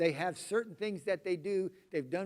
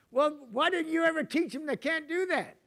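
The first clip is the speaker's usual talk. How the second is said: much higher, loud, accusing comeback; mock indignant retort; 11 semitones higher, 7 dB louder than usual. Wife firing back at her husband, mock indignant. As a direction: hurl the blame right back. Acted quarrel between spouses.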